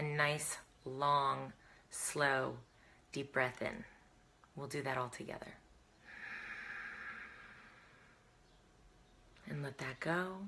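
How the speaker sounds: soft, unhurried breathing-exercise voice; guided breathing. Real, not acted. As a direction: lead them gently through each breath, quietly.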